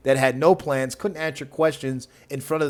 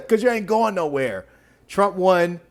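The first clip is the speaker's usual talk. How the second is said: ordinary talk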